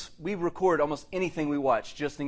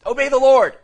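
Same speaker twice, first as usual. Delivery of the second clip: louder, higher mock preacher command to his son; mock stern shout; 8 semitones higher, 13 dB louder than usual. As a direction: bark it out in jest. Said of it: "No, I'm just playing".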